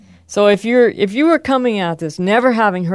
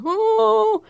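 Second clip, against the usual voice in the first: mock pained yelp. Baby gets whacked in example.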